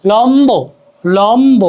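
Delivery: লম্ব with the "b" fully sounded; audible b. Shown as a demonstration. Teacher showing that the b stays, careful লম্ব. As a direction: say লম্ব as "lom-bo", measured and clear. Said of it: "ব এর উচ্চারণ অবিকৃত থাকে".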